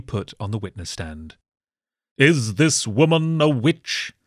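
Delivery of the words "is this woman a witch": stern questioner. Acted. Court questioning boy, slow stern.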